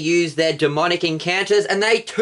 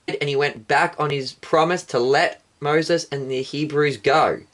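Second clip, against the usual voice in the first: usual speaking voice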